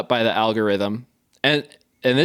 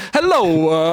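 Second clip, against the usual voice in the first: high, loud put-on "Hello"; a hammed-up TikTok accent hello; mock TikTok voice; 6 semitones higher, 5 dB louder than usual. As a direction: parody a bland influencer greeting. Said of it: "trained to be like".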